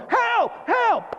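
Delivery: two high-pitched cries for help; imitated horrified screaming. Paralyzed crowd in the shaking building, imitated screams of horror. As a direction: re-enact the panic in high cries. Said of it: "everybody's screaming in horror".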